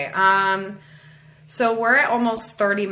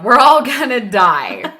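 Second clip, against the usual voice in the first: loud, raised read-aloud cry; child's yell; 4 semitones higher, 8 dB louder than usual. Acted. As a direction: call it out in mild mock panic. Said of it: "when my son yelled".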